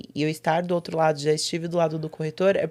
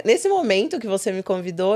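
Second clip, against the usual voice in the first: ordinary delivery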